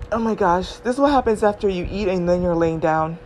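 ordinary talk, nothing marked

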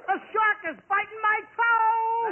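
high, drawn-out wail of alarm; panicked yelp. Acted. Swimmer to Abbott as a shark bites, wailing. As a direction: cry out slowly and high in comic panic.

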